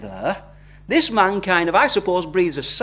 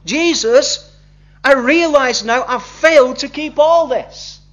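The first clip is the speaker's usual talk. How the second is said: higher and louder, pleading confession; crying out; 5 semitones higher, 5 dB louder than usual. Retold or imitated. Convicted man crying out his sin to Jesus, higher, louder pleading. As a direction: call out to the Lord, earnest and pleading. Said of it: "had sort of said and cried out".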